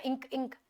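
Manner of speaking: इंक, इंक said very softly, isolating the nk; soft ink sound demo. Shown as a demonstration. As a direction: murmur इंक twice to show the sound. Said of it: "इंक वाला जो sound है ना".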